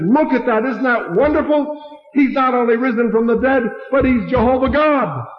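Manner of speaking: high, excited triumph; delighted. Retold or imitated. Preacher's delighted retold argument.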